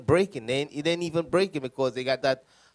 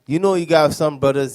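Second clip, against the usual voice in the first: ordinary talk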